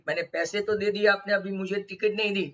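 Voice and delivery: usual speaking voice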